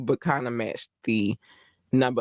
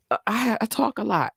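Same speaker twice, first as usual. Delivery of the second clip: mock exasperated squeal; 8 semitones higher than usual. Real, not acted. Exasperated aside about talking.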